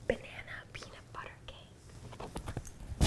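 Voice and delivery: hushed so the dog won't hear; whispering. Real, not acted. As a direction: whisper the secret word. Whispering the treat name to the dog.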